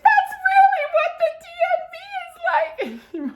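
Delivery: very high, loud tearful squeal; crying with laughter. Retold or imitated. High daughter reacting to Zootopia, crying with laughter. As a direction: squeak it out through helpless laughter. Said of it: "So I'm crying".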